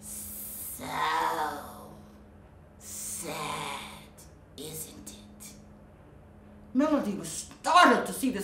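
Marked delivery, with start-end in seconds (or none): 0.0-5.5 low, breathy hiss of a sly snake; sly sea snake. Acted. Sea snake tempting the lonely mermaid, hushed and sly. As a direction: lure her in with a hushed hiss.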